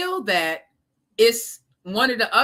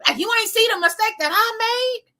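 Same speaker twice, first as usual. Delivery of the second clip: exasperated mom; 13 semitones higher, 4 dB louder than usual. Retold or imitated. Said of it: "sometimes I'll be like".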